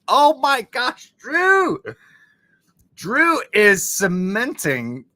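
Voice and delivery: high, loud, slow cry of joy; real teary joy; overwhelmed squeal. Real, not acted. Overwhelmed by donation.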